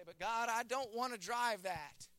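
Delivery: put-on high, drawn-out childish complaint; slow, pleading grumble, put on; mock whining. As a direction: imitate someone balking at God's gift. Voicing a reluctant complaint to God.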